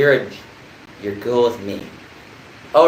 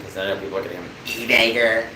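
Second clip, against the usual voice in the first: usual speaking voice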